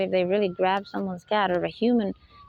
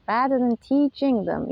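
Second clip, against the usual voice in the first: usual speaking voice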